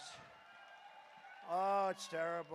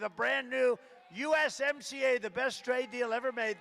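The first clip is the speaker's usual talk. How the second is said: loud, higher rally boast; triumphant rally shout; 4 semitones higher, 7 dB louder than usual. Real, not acted. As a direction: proclaim it to the crowd. Rally boast of new trade deal.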